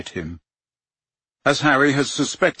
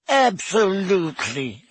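professor voice; 8 semitones higher, slower than usual. A narrator's reading. Sprout voiced.

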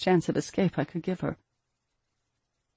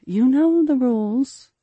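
slow, louder, higher-pitched reminder; firm reminder; 8 semitones higher, 6 dB louder, slower than usual. A narrator's reading. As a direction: draw the rule out, raised and insistent. Mother cuts off daughter's objection.

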